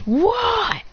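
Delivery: shocked, high and drawn out; a sudden jolt of surprise; startled high what. Acted. Woken ninja, startled by the attack news, high surprise.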